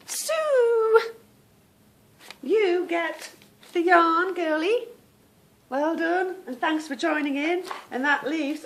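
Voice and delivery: high, loud congratulations; excited winner announcement. Real, not acted. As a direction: sing out the winner's name, high and delighted.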